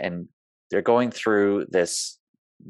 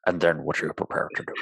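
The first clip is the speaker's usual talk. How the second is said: quicker, lower impression of an old film cop; Sean Connery; 3 semitones lower, faster than usual. Co-host playfully redoing the famous line, Sean Connery impression. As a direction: put on the movie star's voice. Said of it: "Except in a Sean Connery voice".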